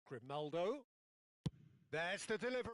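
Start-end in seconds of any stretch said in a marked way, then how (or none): none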